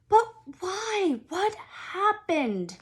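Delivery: lightly voiced boy. A narrator's reading. Harry asks Hagrid what happened, young and puzzled. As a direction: ask it young and bewildered.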